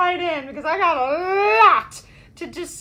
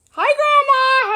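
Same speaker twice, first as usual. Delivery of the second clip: higher, louder cheery hi; excited greeting; 7 semitones higher, 7 dB louder than usual. Real, not acted. Host greeting grandma in the chat, loud and cheery. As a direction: call out a happy hi.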